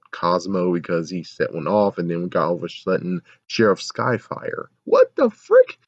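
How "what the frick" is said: very high, loud squeal; mock shocked squeal. Vlogger mocks the sheriff, squealing high. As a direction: squeal it in fake disbelief.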